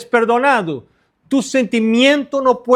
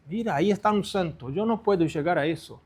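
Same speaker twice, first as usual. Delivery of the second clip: murmullo bajo de admiración imitado; feligreses admirados; 5 semitones lower, 10 dB quieter, faster than usual. Retold or imitated. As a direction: imita a un feligrés impresionado, en voz muy baja. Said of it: "le miran los hermanos, los muchachos". (admiración fingida en voz baja)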